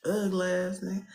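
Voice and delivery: usual speaking voice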